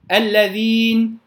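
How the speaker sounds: الذين slowly, without the long hum of a doubled noon; contrast with inna. Shown as a demonstration. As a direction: set الذين apart as its own word. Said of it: "الفارق بين إن والذين". (نطق الذين منفصلة بلا غنة زائدة)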